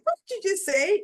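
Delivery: high, loud disbelief at her own words; shocked inner voice. Retold or imitated. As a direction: pitch jumps up, stunned at what she just said. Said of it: "in my head, I'm like".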